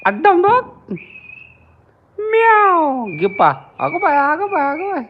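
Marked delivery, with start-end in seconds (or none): 0.0-0.6 high, louder sing-song call to the cat. Real, not acted. Calling the kitten to look, loud high sing-song. 2.2-3.0 high, loud mock meow; meow imitation. Meowing at the pet cat, high mock meow. 3.8-5.0 high, sing-song fond exclamation. Real, not acted. Fussing over the kitten, high baby-talk coo.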